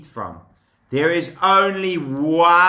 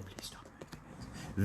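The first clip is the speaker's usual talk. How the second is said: whisper; 23 dB quieter than usual. Real, not acted. Teacher whispering to restless kids. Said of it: "unvoiced whisper to kids".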